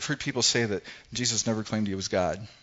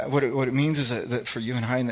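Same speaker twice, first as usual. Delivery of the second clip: usual speaking voice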